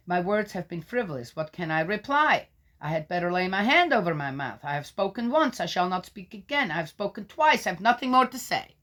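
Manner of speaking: solemn public reading of Job; scripture reading. Acted. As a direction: proclaim it like a lector. Reads Job's reply.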